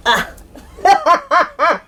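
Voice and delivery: high cackling laughter; real burst of laughter; laughing fit. Real, not acted. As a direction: crack up laughing.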